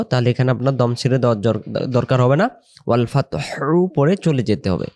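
ordinary talk

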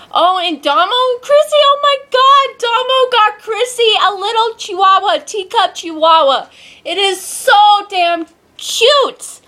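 high, squealing delight over a cute puppy; excited gushing. Real, not acted. Telling viewers about the friends' teacup chihuahua, squealing and excited. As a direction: gush like you just saw the cutest thing.

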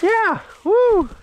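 very high excited cheer for the dog; excited praise. Real, not acted. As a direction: squeal with delight at the dog. Cheering the dog's retrieve.

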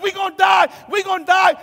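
loud, high cries of panic; panicking. Retold or imitated. Preacher as disciples in a sinking boat, panicked shouting. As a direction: shout it in mock terror. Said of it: "some are panicking".

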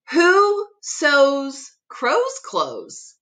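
ordinary delivery, nothing marked

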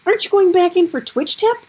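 high, loud urgent question; alarmed plea. Acted. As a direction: demand they go back. Gregor pleads, alarmed.

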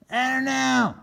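high wary turkey; doubtful and uneasy. Acted. Solomon answering Regina on the run, wary. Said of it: "said Solomon".

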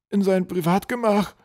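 high, slow, loud troll voice; frightened echo. A narrator's reading. Troll repeats the dreaded destination, frightened. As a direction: repeat it slowly in alarm. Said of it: "Er begann zu zittern".

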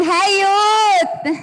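drawn-out, loud group greeting; calling out. Real, not acted. Host greets the youth fellowship, calling out long and loud. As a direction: hail the crowd, stretching the words long.

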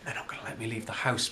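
whispering. Acted. Whispering to Jed.

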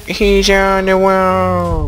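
high, long-drawn warning cry; slow, high cartoon holler; mock enemy alarm. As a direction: sound the alarm in a put-on high voice. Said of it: "They're like".

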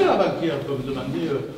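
ordinary talk, nothing marked

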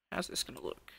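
breathy whisper to himself; whispered musing. Real, not acted. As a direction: whisper the question, wondering. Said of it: "How is this going to look?".

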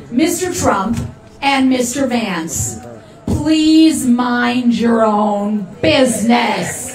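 slow, measured teacher's reprimand; stern teacher voice. Shown as a demonstration. Politician shows the crowd her stern teacher voice. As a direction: put a teacher's firm weight on each word. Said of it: "here's my stern teacher voice".

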